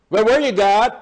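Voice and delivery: high, loud cry of Where were you God; angry prayer. Pretend. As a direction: shout it up at heaven. Complaining to God after trouble.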